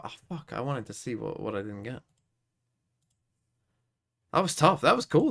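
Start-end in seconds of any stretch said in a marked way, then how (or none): 4.3-5.3 high, loud and bright reaction; excited. Real, not acted. Player reacting to a finished quiz, excited and loud. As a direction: cheer the result out.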